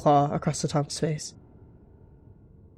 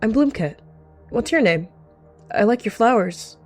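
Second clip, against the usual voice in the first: put-on young kit; 6 semitones higher, 3 dB louder than usual. Kit introducing herself to older cat.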